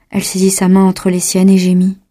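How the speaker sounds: ordinary delivery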